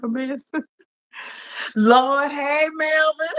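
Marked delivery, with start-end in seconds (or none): none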